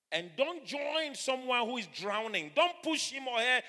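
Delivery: loud, high, slow declaration of faith; full-force preaching. Acted. Preacher urging a congregation not to fear, full-force preaching. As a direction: preach it out loud with stretched words.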